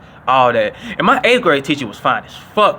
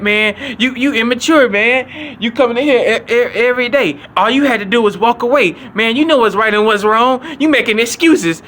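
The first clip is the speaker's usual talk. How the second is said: high, preachy mock teacher voice; lecturing teacher; 8 semitones higher, 3 dB louder than usual. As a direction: scold the kid like the suspension teacher, pitched up.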